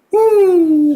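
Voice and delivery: loud, high, drawn-out "woo"; sustained high whoo; whoop. Acted. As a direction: whoop like something just took off. Whoops after fatal giggle in story.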